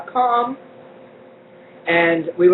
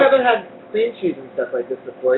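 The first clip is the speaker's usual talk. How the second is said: ordinary delivery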